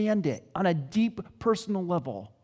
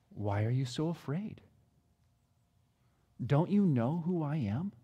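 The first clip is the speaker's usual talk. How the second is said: much softer, lower and tender; gentle question; 4 semitones lower, 6 dB quieter than usual. Acted. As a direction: ask softly, almost consoling. Jesus asks gently, retold again.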